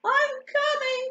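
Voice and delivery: high, squeaky call from afar; crying out. A narrator's reading. Ugly duckling to Mother Duck, crying out. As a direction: pipe it out urgently. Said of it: "cried the ugly duckling".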